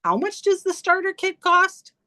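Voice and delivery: a cousin's high, puzzled question; imitating cousin. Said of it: "and he's like".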